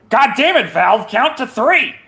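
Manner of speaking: high, loud, slowed-down frustrated shout; exasperated yell. Retold or imitated. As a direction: yell it in fed-up disbelief. Developers yelling at Valve.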